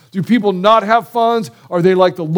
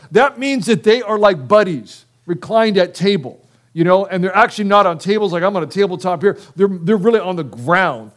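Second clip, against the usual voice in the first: normal talking voice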